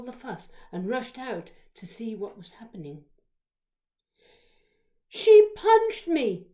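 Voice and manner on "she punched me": high, slow, very loud accusation; drawn-out high-pitched yell; shouting. A narrator's reading. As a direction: yell it out to tell on someone. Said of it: "shouted Charlie".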